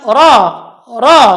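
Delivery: ra with thick full r; ra said heavy, ro-like. Shown as a demonstration. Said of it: "huruf ra itu tebal". (ra dibaca tebal)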